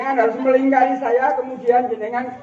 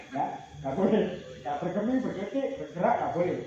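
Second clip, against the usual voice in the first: usual speaking voice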